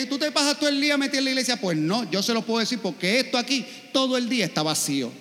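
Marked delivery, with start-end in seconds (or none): 0.0-1.6 high, fast needling question; family needling the churchgoer, high and fast; mocking accusation. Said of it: "la familia y te dice".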